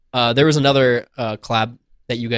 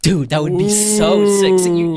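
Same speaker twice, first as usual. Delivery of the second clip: loud, high, drawn-out hype; excited; 7 semitones higher, 6 dB louder than usual. Real, not acted. Co-host thrilled by a Family Feud idea, excited. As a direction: cheer the idea, stretching the words out.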